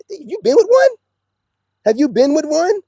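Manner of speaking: a high, loud "really?"; incredulous challenge. Real, not acted. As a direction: fire the question in disbelief.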